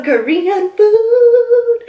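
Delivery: excited. Real, not acted. Announcing the meal excitedly.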